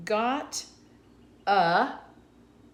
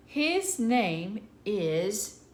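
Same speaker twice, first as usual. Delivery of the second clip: His name is read word by word, very slowly; slow reading aloud; 3 dB louder, much slower than usual. Shown as a demonstration. Teacher to children, reading the sentence slowly. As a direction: drag out His name is for young readers. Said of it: "reading the sentence aloud slowly".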